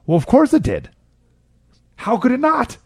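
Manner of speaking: high-pitched mock amazement; incredulous. Real, not acted. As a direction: pitch it up as if it's blindingly obvious. Incredulous about graduation boast.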